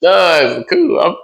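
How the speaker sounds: high, slow, drawn-out rejoicing; mock celebration. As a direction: stretch it out high like you've been released. Acting relief at being dumped.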